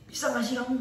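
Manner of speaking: high-pitched mock anger, half laughing; imitated scolding. Boyfriend imitates girlfriend scolding him, high mock anger. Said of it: "dia marah-marah".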